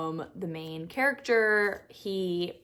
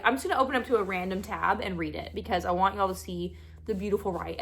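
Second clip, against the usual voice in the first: normal talking voice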